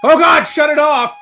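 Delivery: high, very loud yell; panicked shout. Acted. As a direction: yell it over an unbearable noise. Skit, deafened by loud screeching noise.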